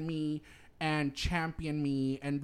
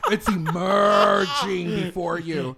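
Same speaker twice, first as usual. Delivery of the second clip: grand proclamation; 9 dB louder, much slower than usual. Pretend. Dramatic proclamation, laughing.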